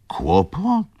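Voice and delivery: very high, rising query; startled question. A narrator's reading. Wokulski hears of trouble from Rzecki, startled question. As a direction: shoot the word up in surprise.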